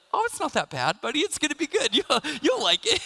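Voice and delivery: high, loud comforting dad breaking into laughter; retold soothing of a child, cracking up; laughing reassurance. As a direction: console a crying kid while laughing. Dad consoling bawling child, laughing.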